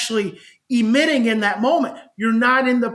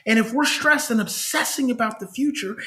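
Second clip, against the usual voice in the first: ordinary delivery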